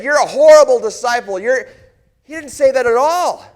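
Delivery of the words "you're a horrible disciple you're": loud, high scolding, cut off short; imagined rebuke. Pretend. Imagined Jesus scolding the doubting disciple, a loud harsh rebuke. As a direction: bark the rebuke then stop dead. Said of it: "chastised me".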